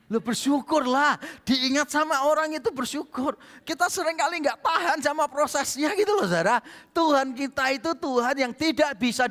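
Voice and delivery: high, loud preacher's build; full-force sermon delivery; fiery preaching. Acted. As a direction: drive it home to the congregation.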